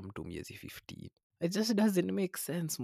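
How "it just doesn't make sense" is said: exasperated. Real, not acted. Exasperated rant.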